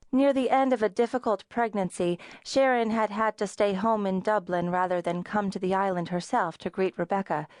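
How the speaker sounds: ordinary delivery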